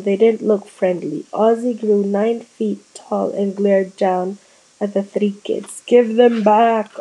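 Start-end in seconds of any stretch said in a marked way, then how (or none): 5.9-6.9 slow, loud, stretched ghostly howl. A narrator's reading. Angry ghost Ozzy howling at the three kids, slow and loud. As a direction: loom over the kids and howl it out. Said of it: "Ozzy howled".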